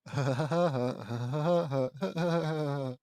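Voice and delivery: long staccato cartoon cackle; attempted Woody Woodpecker laugh. Reader retrying Woody's trademark laugh, staccato cackle. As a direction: rattle off a woodpecker laugh in bursts. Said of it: "Woody does his trademark la".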